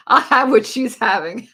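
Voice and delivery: quoted through a loud laugh; laughing movie quote. Retold or imitated. Quoting the diner line to the hobnob video, laughing. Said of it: "It's just me saying".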